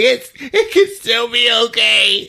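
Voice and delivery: high tearful imitation; hammed-up weepy high voice; mock crying. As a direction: pretend to reassure through tears. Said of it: "She's, like, crying".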